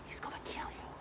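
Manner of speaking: hushed, unvoiced menacing whisper. Mimicking the perps' early taunts in a menacing whisper. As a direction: whisper it like a threat. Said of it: "they whisper, it's like".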